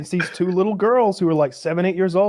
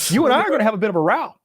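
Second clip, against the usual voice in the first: put-on old-timey tough guy; 6 semitones higher, faster than usual. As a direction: play a comic old-fashioned bruiser picking a fight. Same tough-guy threat continued.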